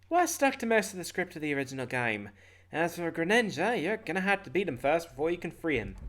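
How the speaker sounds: slightly high exposition voice; [name] explaining. Acted. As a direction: explain it as a game guide.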